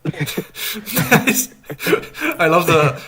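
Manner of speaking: giggling high through the words; laughing. Real, not acted. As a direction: laugh as you say it. Laughing at frozen webcam frame.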